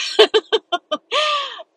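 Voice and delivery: high, bubbly run of laughter. Real, not acted. Podcaster amused by her own cover remark, laughing high.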